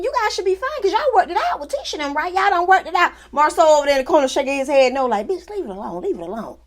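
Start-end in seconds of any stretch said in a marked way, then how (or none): 5.3-6.6 soft, pleading, half-laughing; hushed warning. Retold or imitated. Husband quietly begging his wife to drop it.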